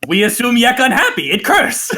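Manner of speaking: very high goblin squawk; high, comic goblin voice. Acted. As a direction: squeak it with a goblin shrug. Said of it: "shrugs and go".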